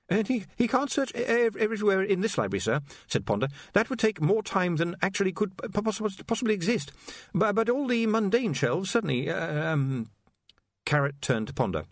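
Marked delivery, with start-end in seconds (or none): 0.1-10.1 high, stammering, anxious voice; nervous young wizard. Acted. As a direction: stumble over the words nervously.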